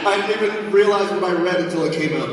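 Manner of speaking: high, bright system announcer; game announcer voice. Acted. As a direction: announce it like a game show host.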